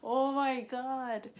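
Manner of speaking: slow hammed-up oh my God; mock shock. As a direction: stretch it out in amused disbelief. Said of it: "I was like".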